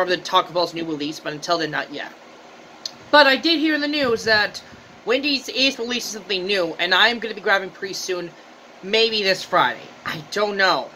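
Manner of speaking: talking while chewing; mouth full. Real, not acted. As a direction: hand covering mouth. Seen 1: Hand pushes food to mouth, then chewing with full cheeks throughout.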